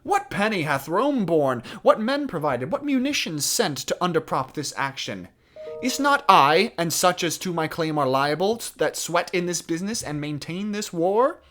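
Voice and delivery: loud, high, declaimed royal outrage; furious. Acted. French prince raging at being bossed around, furious. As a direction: hurl each rhetorical question, building to a shout. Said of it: "he's really furious".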